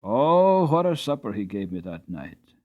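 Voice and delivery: ordinary delivery, nothing marked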